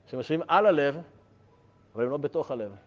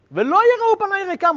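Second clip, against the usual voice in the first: much higher and louder, soaring out the verse; dramatic cry; 9 dB louder than usual. Shown as a demonstration. Teacher repeating the verse to students, now a loud high cry. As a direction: hurl ולא יראו פניי ריקם out like a lament.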